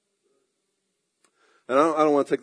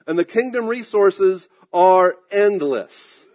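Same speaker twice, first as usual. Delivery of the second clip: building preaching; 3 semitones higher, 4 dB louder than usual. Acted. Preacher to congregation, building to a firm declaration. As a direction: preach it with growing force.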